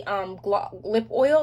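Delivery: ordinary delivery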